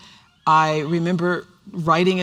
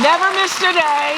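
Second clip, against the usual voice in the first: much higher and louder, triumphant; proud exclamation; 12 semitones higher, 7 dB louder than usual. Real, not acted. Host boasts to guest of never missing a day, triumphant. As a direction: belt it out with pride. Proud claim about her show.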